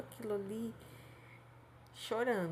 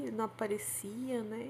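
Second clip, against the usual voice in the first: normal talking voice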